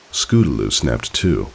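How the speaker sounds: plain reading voice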